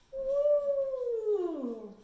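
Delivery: high loud whoop of joy; excited elephant woo. Shown as a demonstration. Teacher as excited elephant spraying water, loud whoop. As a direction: let out a big trumpeting whoo. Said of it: "we're so excited to get that water".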